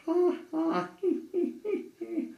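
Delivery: tiny goblin laugh; high goblin giggle. Acted.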